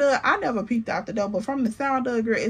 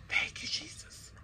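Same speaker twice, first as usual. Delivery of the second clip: soft relieved whisper; whispered thanks; 16 dB quieter than usual. Retold or imitated. Thanking Jesus after finally relieved, a soft whisper. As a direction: breathe it out in relief. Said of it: "you just be like".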